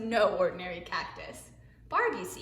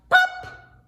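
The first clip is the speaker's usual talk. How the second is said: sharp, much higher and louder POP; punchy sound-effect word; 11 dB louder than usual. A narrator's reading. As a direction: burst out the pop.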